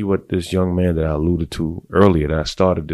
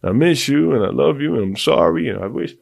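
high, whiny mock plea; pleading love letter, hammed up; 9 semitones higher than usual. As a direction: lay the begging on thick, half-mocking. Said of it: "I would write her like fucking War and Peace".

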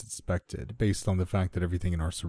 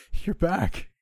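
high-pitched, amazed exclamation; astonished cry; 11 semitones higher, 3 dB louder than usual. A narrator's reading. Narrator grabbing his returned girlfriend, astonished cry.

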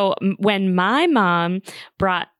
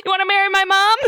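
mock bratty child, higher and louder; cheeky kid; 12 semitones higher, 4 dB louder, faster than usual. Kid snapping at mom's fiance, mock bratty and high. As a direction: sass him like a defiant six-year-old.

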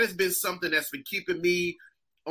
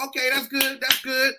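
higher-pitched hurrying along; impatient; 7 semitones higher than usual. Retold or imitated. Comic wanting the other act offstage, impatient. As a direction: cut them off with repeated approval. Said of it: "I'm like".

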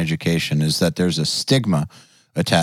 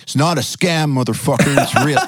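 higher-pitched, hammed-up indignation; mock defensive outburst; 6 semitones higher than usual. Educator acting out how not to answer doubters, mock defensive. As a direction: protest in pretend outrage. Acts out the wrong defensive reply.

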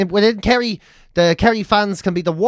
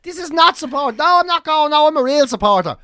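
higher, louder hammed-up terrace abuse; imitating angry fan; 9 semitones higher, 3 dB louder than usual. Fan insisting he's real and abusing the team, higher and louder mock shouting. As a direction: rant like a bitter supporter. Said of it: "He's like".

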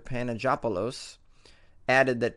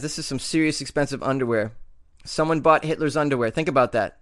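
normal talking voice

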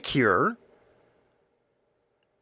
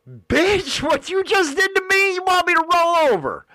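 much higher, fast, disbelieving cry; incredulous outburst; 12 semitones higher than usual. Retold or imitated. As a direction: blurt it out in shocked disbelief. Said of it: "And that's how I said it".

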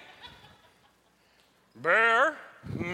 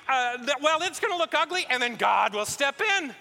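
excited pitch; 6 semitones higher than usual. Acted. David pitches risky plan excitedly.

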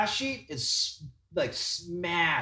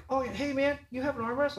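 much higher, eager buddy voice; imitating the artist; 8 semitones higher than usual. Teller imitating the tattoo artist greeting him, high and eager. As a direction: pitch it up like an overfriendly tattoo artist. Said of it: "And then he was like".

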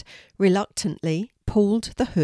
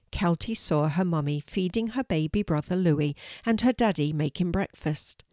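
usual speaking voice

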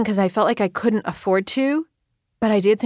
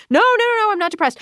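much higher, quick brush-off; mock breezy denial; 13 semitones higher, faster than usual. To her husband, a hammed-up breezy denial. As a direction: wave it away like nothing's wrong.

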